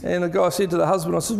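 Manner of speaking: usual speaking voice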